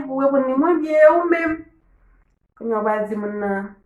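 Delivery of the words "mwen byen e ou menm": acted reply. Acting out greeting dialogue.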